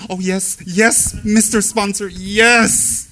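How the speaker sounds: high, loud, slow eager yes-man; fawning guru. Retold or imitated. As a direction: grovel before the sponsor. Guru fawning over sponsor.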